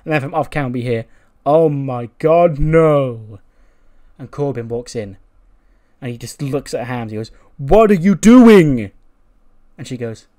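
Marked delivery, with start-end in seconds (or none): 1.4-3.4 loud, slow cry of dismay; dismayed shout. Acted. Acted off-camera cry of dismay. 7.6-8.9 loud, drawn-out, high-pitched acted yell; outraged shout. Acted angry confrontation over food.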